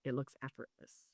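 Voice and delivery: "It looks effortless" repeated almost under the breath; the podcaster echoes the point to listeners, barely audible; near-whispered echo. Shown as a demonstration. Drop to a hushed aside for "It looks effortless".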